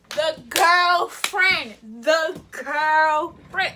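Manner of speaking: loud, very slow, drawn-out disbelief; outraged emphasis. Retold or imitated. Telling y'all who the girl is, slow loud outrage. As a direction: stretch each word out loudly.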